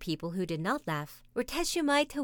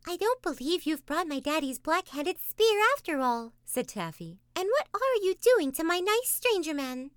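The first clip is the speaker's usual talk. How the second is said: put-on Taffy, excited child; 9 semitones higher than usual. A narrator's reading. Taffy voiced.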